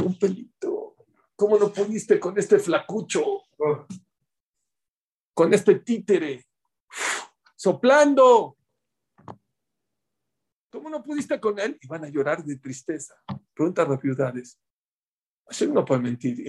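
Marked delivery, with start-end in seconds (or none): 0.0-11.8 lento, más agudo, con desprecio; reproche burlón. Acted. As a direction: burlarse de lo pequeño que fue. Hashem reprocha a los malvados. (Dios se burla de los malvados por caer ante un pelito)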